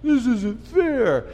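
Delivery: slow, hammed-up pout with hands on hips; mock pouting protest, slow; pouty complaint. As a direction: sulk it out like a child stamping. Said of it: "And put a little pout".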